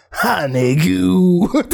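put-on slow, drawled drunk verdict; a hint of a woozy stranger; imitated drunk. Drunks deciding he is nice, slow and slurred.

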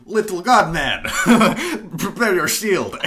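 put-on hammy Russian accent, laughing. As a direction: growl it out in a mock Russian accent. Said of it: "Russian accent".

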